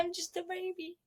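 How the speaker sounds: put-on high, soft little-kid voice; baby voice. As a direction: coo it like a toddler.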